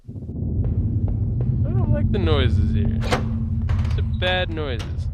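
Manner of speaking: loud, slow, drawn-out mock dread; hammed-up scared voice. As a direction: stretch every word like a spooked cartoon. Creeped out by noises.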